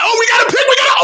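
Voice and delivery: very high excited yell; real thrill at a turnover; high burst of game excitement; excited shout. Real, not acted. Interception during play-by-play.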